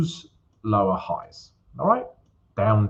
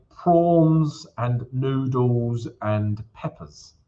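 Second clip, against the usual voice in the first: normal talking voice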